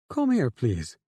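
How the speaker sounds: a little overly friendly invitation; too friendly. A narrator's reading. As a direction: coax him over, a touch too sweet. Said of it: "in a much too friendly way".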